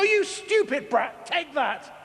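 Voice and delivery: high, slow mock-furious snap; mock rage. Parent snapping at child over spilt water, mock fury. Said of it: "and you go".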